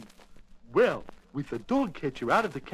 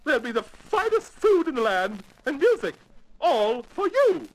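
high, gushing royal lady voice; effusive thanks in a high regal voice; grateful Queen; 10 semitones higher than usual. Acted. Queen thanks Alice for getting rid of the mouse, gushing.

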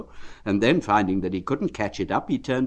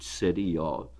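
ordinary delivery

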